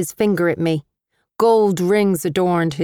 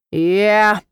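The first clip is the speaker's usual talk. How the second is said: gruff growl; 2 semitones higher, 4 dB louder than usual. A narrator's reading. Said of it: "Richard's gruff growl".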